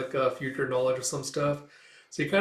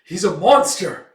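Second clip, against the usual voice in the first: bitter accusation; 6 semitones higher, 9 dB louder than usual. Retold or imitated. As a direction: spit out his hatred. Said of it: "He's like".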